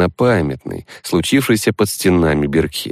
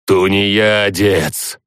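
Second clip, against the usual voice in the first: slow, drawn-out booming dead voice; thundering undead chorus; much slower than usual. Acted. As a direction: boom it out like a crowd of corpses. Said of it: "громыхнуло со всех сторон".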